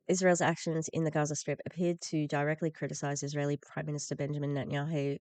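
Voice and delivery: ordinary talk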